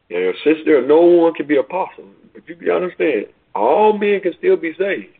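high, rising preacher's call, building in force; impassioned preaching. Acted. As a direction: press each question on the congregation like a revival preacher.

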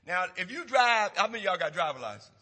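loud, driving pulpit preaching; preaching at full force. Acted. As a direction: hammer it home to the congregation.

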